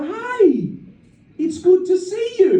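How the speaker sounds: high, bright-eyed greeting, loudly welcoming; excited driver. Retold or imitated. Driver greeting a passenger in his car, excited. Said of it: "He was excited".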